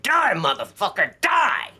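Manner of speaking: high rising snarl, last word shot up; retold threat. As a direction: spit it like an attacker in his ear. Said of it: "leaned down in my ear and said".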